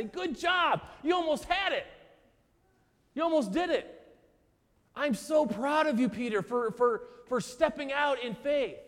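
imagined Jesus slapping Peter's back, gushing mock praise. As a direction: gush like a proud coach, hammed up.

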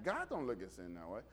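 normal talking voice

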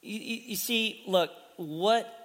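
ordinary delivery

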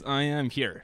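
usual speaking voice

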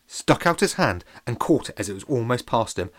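ordinary delivery, nothing marked

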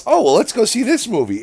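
high, loud, sugary offer; mock-innocent cheerful invite; sweet-talking girlfriend. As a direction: pitch it up high to sell the movie.

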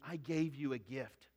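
God speaking softly to his people; God's soft voice. Acted. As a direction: hush "I gave you a gift" like a gentle reproach. Voicing God's gentle reproach.

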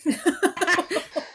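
a sudden, loud, high laugh bursting out; burst of laughter. Real, not acted. Podcast host laughing before her rant. Co-host recalls hated dance.

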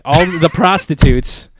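PROSTITUTES drawn out loud and high, laughing; pointed correction. Real, not acted. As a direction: lean hard on "prostitutes" through a laugh. Exaggerated self-correction of a term.

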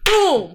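sudden high-pitched "boom" for a fall; impact sound. Retold or imitated. Friend telling of a fall downstairs, punchy imitated thud. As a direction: drop one punchy crash word.

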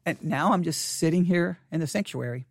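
ordinary talk, nothing marked

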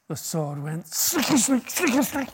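rising to a high squeak on the last snicker snick; mock-dramatic nonsense verse; playful recital. Shown as a demonstration. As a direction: build it up and squeak the end. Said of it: "I could never say it without laughing".

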